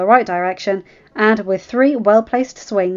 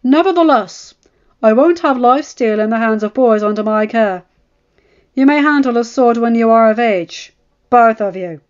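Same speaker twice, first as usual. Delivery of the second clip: loud, firm master-at-arms voice; stern old knight; 4 semitones higher, 7 dB louder than usual. Acted. Old knight forbidding boys steel swords: loud stern.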